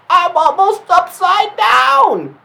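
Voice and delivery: high, loud, slow cry; yelling as the truck tips him over; panicked kid shout. A narrator's reading. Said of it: "Dinky was like".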